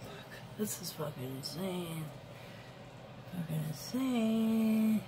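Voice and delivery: soft, disbelieving swearing to oneself; hushed, real upset; dismayed muttering. Real, not acted. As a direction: curse under your breath, away from the mic.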